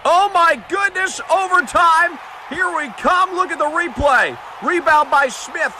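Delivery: ordinary talk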